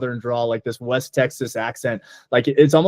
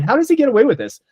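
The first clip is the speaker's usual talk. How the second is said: incredulous; 9 semitones higher, 4 dB louder, faster than usual. Retold or imitated. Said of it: "I'm like".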